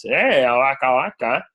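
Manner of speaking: slow Muppet comedian voice; drawn-out comic bear catchphrase; Fozzie. Shown as a demonstration. Host showing Frank Oz voices, Fozzie impression. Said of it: "he kinda talks like this".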